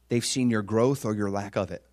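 normal talking voice

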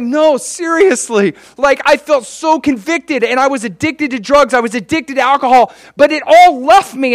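high, urgent, overjoyed outpouring; gushing testimony. Acted. Freed addict convincing a stranger, gushing testimony. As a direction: win over the sceptic with bubbling conviction.

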